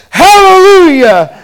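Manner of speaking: high, loud, slow ecstatic Hallelujah; joyful shout. Retold or imitated. Bill rising from baptism water, ecstatic shout. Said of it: "he goes".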